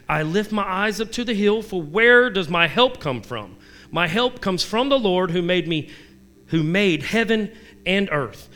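preacher reading a psalm; scripture reading. Acted. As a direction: proclaim the psalm.

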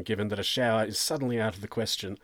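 ordinary delivery

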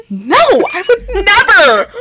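high, drawn-out mock protest; hammed-up indignant wail; scandalized denial. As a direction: imitate an affronted denial, slow and high. Housemate's indignant denial, retold.

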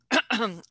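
a real, short clearing of the throat. Real, not acted. Teacher to class, pausing to clear her throat. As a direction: clear your throat mid-lesson. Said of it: "Excuse me for one second".

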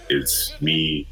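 usual speaking voice

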